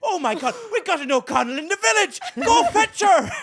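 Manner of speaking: high, loud, laughing villager's excitement; laughing mock shriek. Villager thrilled to find an O'Connell in the village, laughing shriek. Retelling an SNL sketch's villager.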